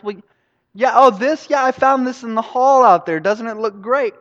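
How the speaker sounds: high, pleased, chatty voice; oblivious designer. Acted. Designer showing off a found lab coat, high and oblivious. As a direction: show it off, blithely missing the emergency.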